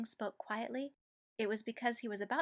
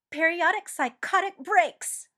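loud, much higher, slow and biting; snapped; 9 semitones higher, 14 dB louder than usual. A narrator's reading. Ed snapping back at Mustang in anger. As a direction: spit each word out. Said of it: "Ed snapped".

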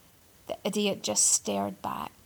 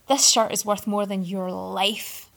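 scornful; 7 dB louder than usual. A narrator's reading. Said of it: "Arthur scoffed".

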